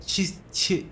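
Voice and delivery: "Çi, çi" as sputtered, voiceless bursts; mimicked odd consonants. Driver mimicking a grocer's unintelligible speech, "Çi, çi" sputtered voiceless. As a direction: imitate garbled consonants on "Çi, çi". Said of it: "C'lere böyle T gibi söylüyor".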